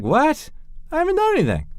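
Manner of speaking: high whiny protest; whiny protesting teen. Retold or imitated. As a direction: squeak it out defensively. Ben protesting, voiced.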